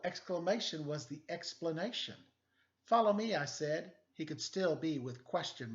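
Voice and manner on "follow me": louder, commanding call; firm call. Acted. Detective ordering the letters to follow, firm. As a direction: lead them on.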